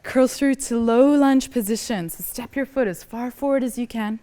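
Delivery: ordinary talk, nothing marked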